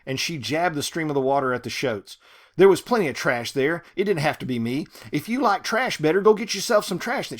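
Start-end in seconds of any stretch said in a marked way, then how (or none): 2.6-7.3 loud, raised voice hurling it at God; loud angry rant. Acted. Ruby yelling at God over the hogs, loud and angry. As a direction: shout it up at the sky in outrage.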